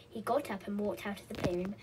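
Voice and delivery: usual speaking voice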